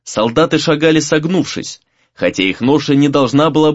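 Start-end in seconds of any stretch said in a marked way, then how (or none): none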